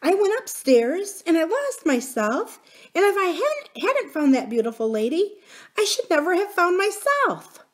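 put-on high, earnest child's voice; a small princess pleading to be believed; little princess. A narrator's reading. Princess insists on her story.

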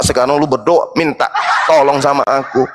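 normal talking voice

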